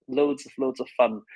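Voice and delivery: normal talking voice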